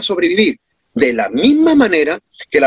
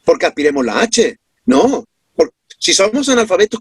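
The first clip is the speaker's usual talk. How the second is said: ordinary talk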